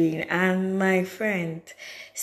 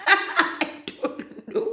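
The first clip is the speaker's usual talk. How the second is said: laughing; 11 semitones higher, much slower than usual. Real, not acted. Laughs at own story.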